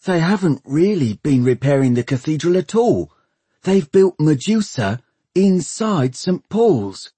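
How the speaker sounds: high, urgent disbelief; shocked young man. Acted. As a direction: voice Bevis, appalled. Bevis, shocked discovery.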